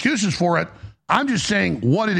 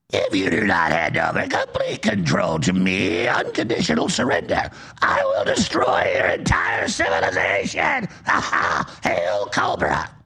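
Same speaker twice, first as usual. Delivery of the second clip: much lower, slow villain voice with a mock evil laugh; Cobra Commander; 12 semitones lower, slower than usual. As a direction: ham up a cartoon commander's threat, then cackle. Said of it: "Or like Cobra Commander".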